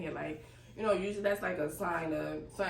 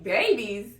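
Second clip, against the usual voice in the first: loud, high-pitched mock disbelief; incredulous retold exclamation; reenacted shock; 7 semitones higher, 8 dB louder than usual. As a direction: relive the stunned outburst, pitched up and louder. Retelling shock at hearing babies.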